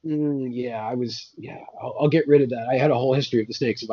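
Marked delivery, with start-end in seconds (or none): none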